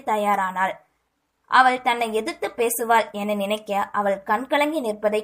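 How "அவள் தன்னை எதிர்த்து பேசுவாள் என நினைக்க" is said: ordinary delivery, nothing marked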